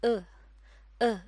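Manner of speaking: अ as a short, light schwa; a small, light uh sound; schwa demo. Shown as a demonstration. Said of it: "इसकी आवाज़ है".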